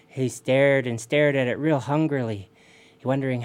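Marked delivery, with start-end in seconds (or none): none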